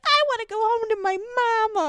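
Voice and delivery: put-on high, fast whimpering boy; mock tearful high child voice; crying boy voice. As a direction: imitate a sobbing little kid. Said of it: "He's like".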